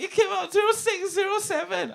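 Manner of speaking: very high, sing-song wail; half sing the number in a squeaky auntie voice; godma voice. Retold or imitated. Godma wailing to Jackie about the lottery, squeaky sing-song.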